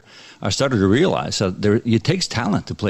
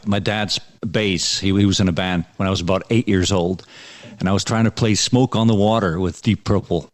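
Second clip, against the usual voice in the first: ordinary talk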